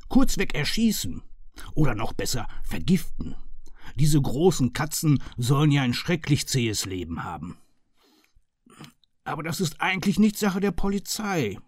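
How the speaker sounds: loud, eager, mock-enthusiastic chief inspector; fired up. A narrator's reading. Murphy cheerfully agreeing to kill the panthers, loud and eager. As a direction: throw yourself into it with relish. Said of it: "war dafür Feuer und Flamme".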